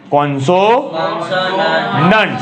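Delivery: "કોન્સોનન્ટ્સ" stretched very slowly, every sound drawn out; drawn-out classroom pronunciation; slow teaching demo. Shown as a demonstration. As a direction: spell it out slowly for students. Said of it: "આને શું બોલતા શીખવું પડે?".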